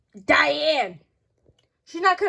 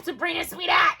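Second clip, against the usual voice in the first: normal talking voice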